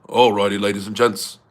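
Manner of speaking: deep-voiced intro impression; low mock presenter greeting; Ryan Bowen. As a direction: clear the throat and go low. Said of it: "Ryan does have a deeper voice".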